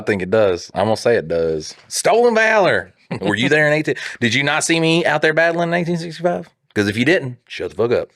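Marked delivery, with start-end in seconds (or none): 1.9-6.5 higher-pitched, playfully outraged challenge; lightly hammed veteran's protest; mock indignation. Fake veteran challenging co-host over service, mock indignant.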